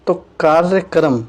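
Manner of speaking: usual speaking voice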